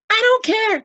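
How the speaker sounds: high, loud defiant cry. A narrator's reading. Boy defending his friend, loud and high. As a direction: insist with all his heart. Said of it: "cried Lois".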